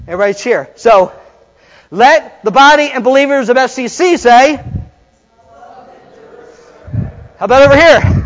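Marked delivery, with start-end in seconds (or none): 1.9-8.1 loud, high call to the congregation; rallying the crowd. Acted. Preacher playing wrestler rousing each side of the congregation, loud rallying call. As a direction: whip the room into answering back.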